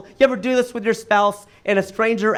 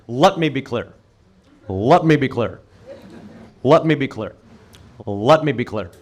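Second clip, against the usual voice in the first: low, measured, deliberate repeats; Obama impression; 6 semitones lower than usual. Comedian repeats Obama's catchphrase, in a lower, measured voice. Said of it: "He'd say that all the time".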